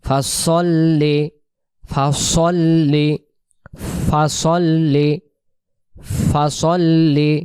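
ফাসল্লি said slowly with the doubled l held; slow teaching repetition of ফাসল্লি; slow demo of fasolli. Shown as a demonstration. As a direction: drill ফাসল্লি syllable by syllable, leaning on the ll. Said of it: "উচ্চারণ".

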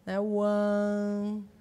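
normal talking voice

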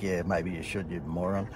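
a low snide aside he'd never say aloud; muttered aside. Retold or imitated. Muttered private retort.

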